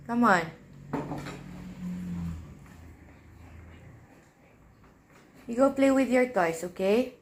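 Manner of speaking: soft, patient coaxing for a little one; gentle mom voice. Real, not acted. Mother coaxing her daughter to go play instead.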